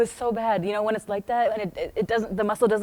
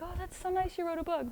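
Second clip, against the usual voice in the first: mock sweet; 10 semitones higher, 9 dB quieter than usual. Mock sweet brush-off quote.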